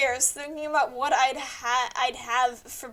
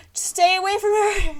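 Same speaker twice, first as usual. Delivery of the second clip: urging shout; 5 semitones higher, 8 dB louder than usual. Real, not acted. Shouting advice at game.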